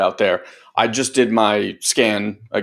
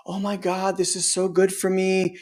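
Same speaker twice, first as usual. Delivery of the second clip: higher, softer mock gushing; mock higher self; 7 semitones higher, 6 dB quieter than usual. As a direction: play the blissful higher self, tongue in cheek. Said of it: "Not your higher self, not like".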